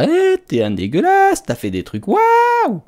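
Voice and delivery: high squeaky mock commenter. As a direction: mimic a shocked viewer, way up high. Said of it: "commencez pas à dire dans les commentaires". (voix aiguë moqueuse d'un commentateur choqué)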